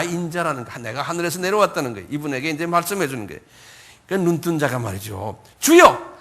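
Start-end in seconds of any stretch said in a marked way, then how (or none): 5.6-6.2 high, loud acted exclamation; sudden raised-voice invocation; fervent cry of faith. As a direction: call out to the Lord. Healed man cries out in faith.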